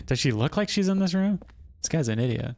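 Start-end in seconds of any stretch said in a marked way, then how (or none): 0.1-1.4 sarcastic. Real, not acted. Mocking her own question.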